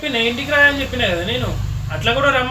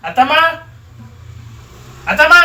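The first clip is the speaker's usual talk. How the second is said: loud, higher call for mother-in-law; 6 semitones higher, 8 dB louder than usual. Real, not acted. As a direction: shout for someone out of the room, second call louder. Calls mother-in-law during quarrel.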